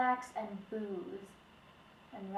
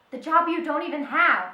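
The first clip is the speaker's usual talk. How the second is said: angry; 5 semitones higher, 8 dB louder than usual. Acted. Widow jabs at his lost job.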